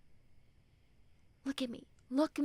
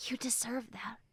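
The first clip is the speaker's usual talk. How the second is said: breathy whisper of reassurance; 2 semitones lower, 8 dB quieter than usual. Acted. Friend whispering comfort to a hurting listener. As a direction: whisper it close, like a secret.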